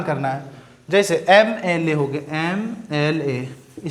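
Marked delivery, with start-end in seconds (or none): 2.4-3.9 एम एल ए drawn out slowly, each letter sounded; slow pronunciation demo. Shown as a demonstration. Teacher sounding out एम एल ए for students, slowly. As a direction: show how एम एल ए starts with the vowel sound em. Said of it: "लेकिन इसका उच्चारण देखिए".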